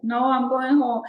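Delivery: flatly refuse, loud and high; stubborn refusal. Retold or imitated. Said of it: "I was like".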